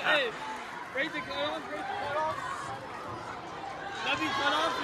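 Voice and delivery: ordinary talk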